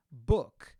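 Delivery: Book with a sharp dry K and no vowel after it; dry final K. Shown as a demonstration. Teacher shows students Book ending in a dry K. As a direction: stop Book dead on the K. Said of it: "Termina com K seco".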